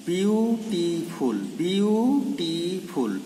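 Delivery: বিউটিফুল drawn out very slowly in three syllables, biu-ti-ful; slow teacher's syllable count on বিউটিফুল. Shown as a demonstration. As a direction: stretch each syllable of বিউটিফুল so it can be counted. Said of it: "খুব ভালো করে আমরা একটু শুনবো".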